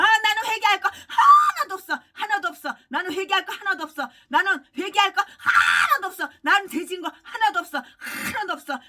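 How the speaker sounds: shouted mocking impression of a pastor denying sin; mock defiant pastor. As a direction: sneer it out as the unrepentant pastor. (회개를 거부하는 목사 흉내, 비웃듯 외치며)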